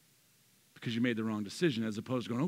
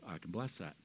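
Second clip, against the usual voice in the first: much softer and lower, a quiet blessing; hushed voice of God granting a blessing; gentle God voice; 5 semitones lower, 8 dB quieter than usual. Pretend. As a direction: let God answer softly and kindly.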